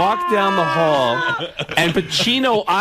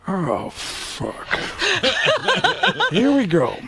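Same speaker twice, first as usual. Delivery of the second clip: weary Pacino groan, laughing through it, drawn out; Al Pacino impression; 8 semitones higher, much slower than usual. Comic telling a story, as Pacino, laughing. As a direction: sigh it like a put-upon Al Pacino. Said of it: "the Pacino looked and went".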